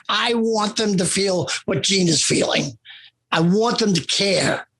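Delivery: usual speaking voice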